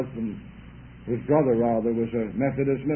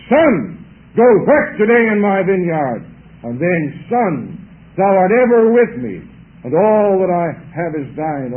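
fervent preaching; 2 semitones higher, 8 dB louder than usual. Real, not acted. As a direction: preach it out loud to the congregation. Preacher rises to full force.

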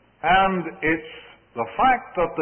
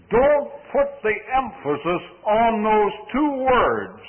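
normal talking voice

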